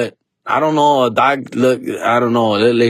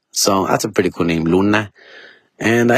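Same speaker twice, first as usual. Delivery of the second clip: ordinary talk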